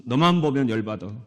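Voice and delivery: playfully grumpy line to a neighbour; mock irritated greeting. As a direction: greet them with teasing annoyance. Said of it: "이렇게 한번 인사합시다". (장난스럽게 짜증 난 듯한 인사)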